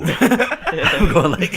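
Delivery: high, slow, loud laugh; loud laughing disgust; laughing shriek. Real, not acted. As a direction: shriek with laughter at the blue meat. Laughing shout at blue turkey.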